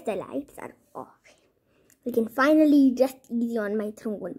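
ordinary delivery

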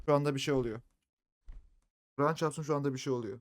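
ordinary talk, nothing marked

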